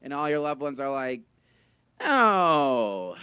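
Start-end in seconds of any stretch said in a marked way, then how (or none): 2.0-3.2 mock protest, loud and drawn out; a long, falling, insistent no; exaggerated reassurance. Loved ones tell him he's not unfuckable, exaggerated reassurance.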